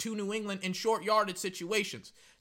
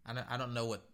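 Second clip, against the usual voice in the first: normal talking voice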